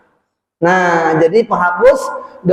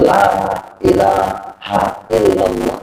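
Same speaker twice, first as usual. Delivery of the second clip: talqin whisper to the dying; 13 semitones lower, slower than usual. Shown as a demonstration. Preacher shows how to prompt a dying person: low, slow murmur. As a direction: murmur "La ilaha illallah" slowly, low, as if at a deathbed.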